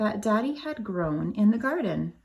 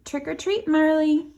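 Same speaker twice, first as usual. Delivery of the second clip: high, bright sing-song greeting; higher and louder festive call; cheery title call; 8 semitones higher, 4 dB louder than usual. Acted. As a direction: call it out to the little listeners.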